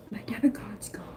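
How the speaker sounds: breathless whispered panic; panicked whisper. Retold or imitated. Retelling losing her debit card, whispered panic. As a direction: whisper it in dread.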